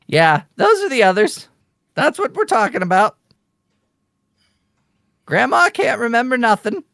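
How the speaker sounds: high, heckling raven voice; squawking bird. Acted. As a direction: squawk complaints about grandma as the bird. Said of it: "continues to squawk like".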